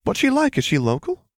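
high, eager questions; pry happily, pitch soaring; excited friend wanting every detail; cheerful curiosity. A narrator's reading. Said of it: "she said cheerfully".

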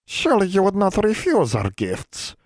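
high, sly, coaxing fae voice. A narrator's reading. Fae in snakeskin coaxing the girl to accept gifts. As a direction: lure her in sweetly. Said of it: "coaxed Leoluco".

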